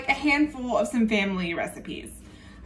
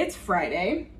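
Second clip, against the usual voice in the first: usual speaking voice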